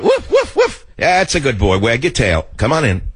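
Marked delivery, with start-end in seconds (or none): 0.0-0.9 very high-pitched little woofs; mock dog bark. Host plays a dog being called in, high mock barking. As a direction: yip like a small dog.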